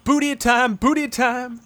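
high imitated catchphrase; Al Bundy. Quoting Ed O'Neill's bit to friends, high playful imitation. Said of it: "Al Bundy, remember".

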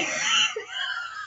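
squealing high laugh. Real, not acted. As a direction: burst into a shrill laugh. Laughing at the story.

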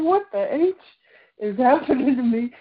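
high-pitched retold disbelief with a laugh; exasperated, half-amused alarm; bewildered. Recalling everything shutting down, bewildered.

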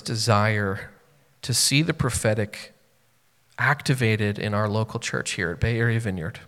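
soft, earnest closing prayer; heartfelt prayer. Acted.

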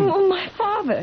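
high, frightened cry; sudden fear for her father; fearful. Acted. Bride realising her father is in danger, frightened.

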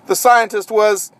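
usual speaking voice

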